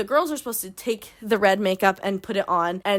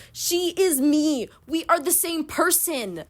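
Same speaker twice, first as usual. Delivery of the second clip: high, drawn-out insistence; dramatic, high-pitched solidarity; mock-fervent declaration; 7 semitones higher, slower than usual. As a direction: declare it with over-the-top conviction. Mock-fervent identification with the heroine.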